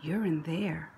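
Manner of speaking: plain reading voice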